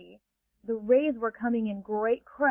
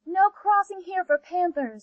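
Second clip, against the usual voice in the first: rays barring the way, much higher; higher, firm ray voice; 7 semitones higher than usual. Acted. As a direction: flatly refuse entry. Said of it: "said the rays".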